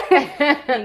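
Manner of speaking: bursts out laughing mid-sentence; real laugh breaking in. Real, not acted. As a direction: laugh through the words.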